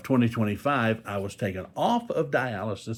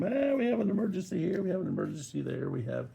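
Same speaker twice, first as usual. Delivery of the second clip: high-pitched, hurried parody of hospital announcements; mock PA announcer; 9 semitones higher, 4 dB quieter than usual. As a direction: rattle off mock emergency alerts, starting high. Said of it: "announcements over the P A system".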